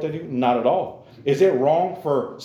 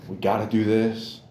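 lower, grudging groan of a chore; mock reluctant moan; 6 semitones lower, 5 dB quieter than usual. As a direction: drag the words out like a reluctant chore. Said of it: "are we over here like".

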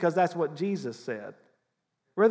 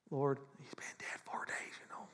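a whispered, nervous plea; anxious whisper; 3 semitones lower, 7 dB quieter than usual. Retold or imitated. Mocking a panicky Jesus at Lazarus's tomb, whispered. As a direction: hush it like a worried beggar. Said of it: "Jesus didn't walk up there and go".

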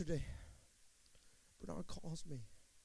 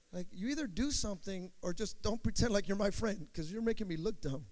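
ordinary talk, nothing marked

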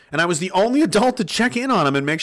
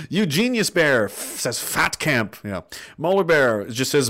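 ordinary talk

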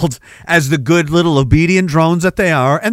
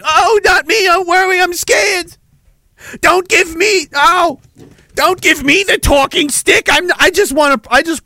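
mock scared; 13 semitones higher than usual. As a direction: ham up a cowardly panic. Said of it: "dropped it and went".

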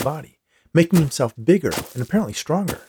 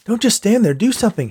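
much higher, louder urgent call; urgent plea; 10 semitones higher, 4 dB louder than usual. Acted. Boy ducking snowballs urges the snowman, loud and urgent. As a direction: hurry him up under fire. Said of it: "he urged".

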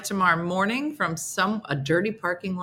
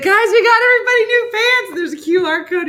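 much higher, louder giddy pitch; shrill, way up and loud, cheery mock hype; mock hyped announcement; 13 semitones higher, 10 dB louder than usual. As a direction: hype the crowd like a tour guide. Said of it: "I'd be like".

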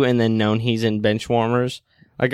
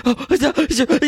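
high, breathless starstruck stammering; squeaky-high, tripping over the words in hammed-up excitement; mock freak-out; 11 semitones higher than usual. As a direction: imitate an overexcited fan losing all coherence. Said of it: "You're freaking out".

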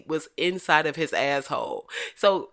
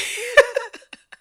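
loud burst of laughter; 6 dB louder than usual. Real, not acted. Laughs at own impression.

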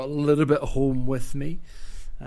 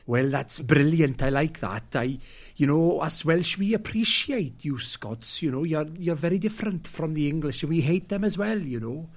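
lilting, higher-pitched imitation of a Welsh accent; 3 semitones higher than usual. Welsh local appreciating the Scots, put-on Welsh accent. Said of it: "That was a good Welsh accent".

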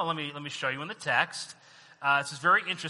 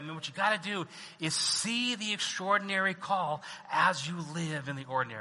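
normal talking voice

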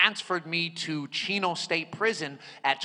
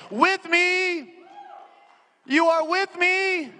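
high, loud preacher voice; full-force preaching; 9 semitones higher, 3 dB louder than usual. Acted. Preacher rousing the congregation about approaching God, full-force preaching. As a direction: preach it to the room.

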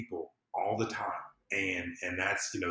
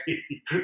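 short laugh; 7 semitones higher, 4 dB louder than usual. Real, not acted. Laughs at own quote.

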